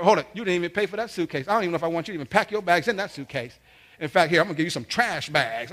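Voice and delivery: loud rapid telling-off as mom; scolding mom. Retold or imitated. Mom denies son the suitcase, loud scolding. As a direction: imitate mom piling on orders.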